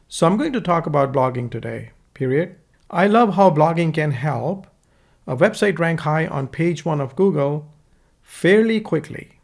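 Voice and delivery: ordinary talk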